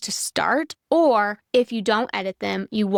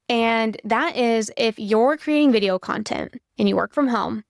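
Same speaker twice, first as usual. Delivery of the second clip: ordinary talk, nothing marked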